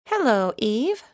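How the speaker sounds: ordinary talk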